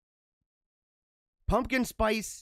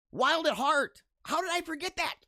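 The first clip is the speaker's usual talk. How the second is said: high-pitched burst of sudden recollection; excited recall; 9 semitones higher than usual. Real, not acted. Host finally remembers the movie title, excited. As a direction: blurt it out, thrilled to finally remember.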